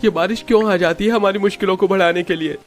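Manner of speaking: high-pitched character complaint; exasperated lament. Acted. (बारिश को कोसती ऊँची आवाज़)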